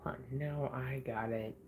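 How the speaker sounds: dropped to a low, near-silent murmur; hushed. Real, not acted. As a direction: say it under your breath, almost to yourself. Quietly checking found information.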